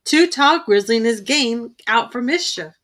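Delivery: usual speaking voice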